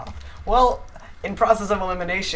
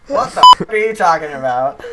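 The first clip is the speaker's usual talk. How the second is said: shocked outburst; 10 dB louder than usual. Real, not acted.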